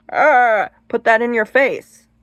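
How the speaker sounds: loud, pushy mock confrontation; aggressive. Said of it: "that's gonna be seen as aggressive".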